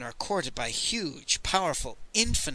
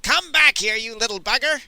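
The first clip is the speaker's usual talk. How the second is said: much higher, much louder gleeful shout; happy bellow; 8 semitones higher, 7 dB louder than usual. Acted. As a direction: holler after a runaway boy. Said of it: "a happy voice that bellowed".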